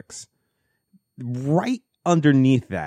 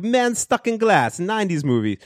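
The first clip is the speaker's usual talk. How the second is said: put-on botched Polish accent; 9 semitones higher than usual. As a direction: draw it out in a badly imitated Polish accent. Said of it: "I was doing a better accent of him earlier".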